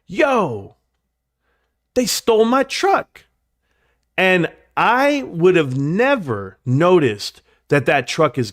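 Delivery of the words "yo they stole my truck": imitating dad, pitched much higher in alarm; dad's shocked outburst. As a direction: play the dad spotting the empty driveway, voice jumping up. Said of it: "and he goes".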